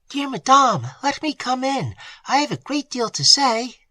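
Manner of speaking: high, urgent maid at the door; a frightened servant girl pleading to be let in; anxious maid Annette. A narrator's reading. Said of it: "the whispering voice of Annette".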